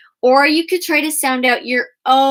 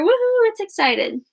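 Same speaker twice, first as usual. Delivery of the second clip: much higher, bubbly cheer; excited woohoo; 8 semitones higher than usual. Shown as a demonstration. Teacher voicing the exclamation point cheering, excited. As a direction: celebrate like the punctuation mark. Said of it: "It throws its arm up".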